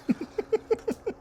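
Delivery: squealing laugh; sudden high giggle; high laugh burst. Real, not acted. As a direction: let out a high shriek of laughter.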